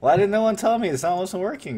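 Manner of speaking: loud, high-pitched indignant question; indignant, starting loud and high; exasperated complaint. Real, not acted. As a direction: demand an answer from your audience, really put out. Scolds viewers for not telling him.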